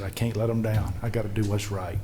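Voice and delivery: soft, low retelling of a tearful resolve; subdued retold tearfulness. Crying father resolves to do right, subdued. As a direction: quote the crying man quietly, no sobs. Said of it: "Joey's sitting in the corner crying".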